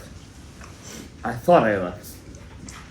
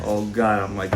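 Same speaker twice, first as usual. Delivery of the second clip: normal talking voice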